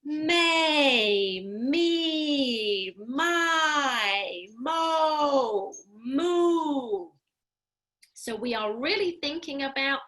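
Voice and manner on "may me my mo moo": loud, projected syllables; intentional voice. Shown as a demonstration. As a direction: call each one out with intent. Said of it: "keep thinking you are doing this with intent".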